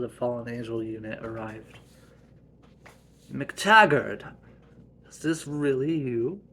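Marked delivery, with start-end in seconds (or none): 3.3-6.4 loud startled call; shocked shout. Acted. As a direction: cry out in disbelief. Shocked at arrival.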